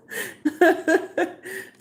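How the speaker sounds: high, loud cackling laugh; high burst of laughter. Real, not acted. Laughs at her own line.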